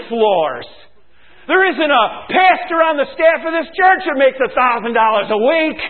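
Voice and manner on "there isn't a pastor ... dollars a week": louder, higher, driving each word; emphatic shout. Real, not acted. Preacher shouting at the congregation about pay. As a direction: hammer it home.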